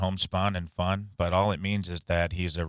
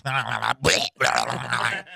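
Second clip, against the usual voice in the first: higher buzzy voice with gurgling filler; mumbling country doctor; 4 semitones higher, 3 dB louder, slower than usual. Retold or imitated. Doctor explains blood tests to patient, mumbling impression. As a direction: dissolve each phrase into fly-like mumbles. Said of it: "Did you just throw up".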